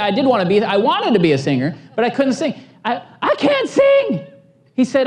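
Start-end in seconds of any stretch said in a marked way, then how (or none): 2.8-4.3 high, whiny mock protest. Pastor protesting to God, high whiny mock protest. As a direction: squeak out the excuse in disbelief.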